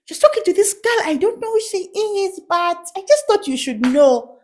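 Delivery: high-pitched tattletale voice; put-on high informer voice; church informant. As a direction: imitate a gossip reporting to Mama. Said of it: "They'll be like".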